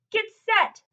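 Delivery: loud, high starting call; bellowed. A narrator's reading. Coach calling the swimmers to set, loud bellow. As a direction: boom it out like a starter. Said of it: "bellowed the coach".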